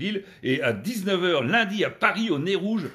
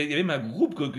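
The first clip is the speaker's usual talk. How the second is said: ordinary talk, nothing marked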